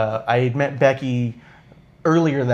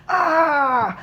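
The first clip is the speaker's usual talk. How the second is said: high, drawn-out celebratory "aaah"; Seth Rollins. Retold or imitated. Fan imitating a wrestler posing with the title, high triumphant cry. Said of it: "does, like, a classic Seth".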